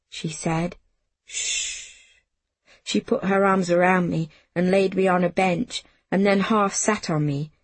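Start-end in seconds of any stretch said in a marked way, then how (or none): 1.2-1.8 long, soft "shh"; soft calming hiss; soothing shush. A narrator's reading. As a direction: hush a frightened child gently. Susan hushes the screaming child.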